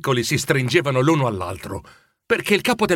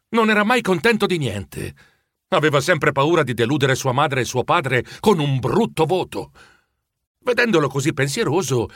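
ordinary delivery

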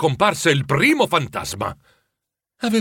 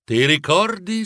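gentle ghost; 4 semitones higher than usual. A narrator's reading.

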